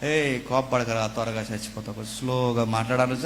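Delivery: slow, soft, mock-soothing advice; mock slow calm talk. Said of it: "స్లోగా మాట్లాడి జాగ్రత్తగా ఉండండి". (నెమ్మదిగా, మెల్లగా ఎగతాళి స్వరం)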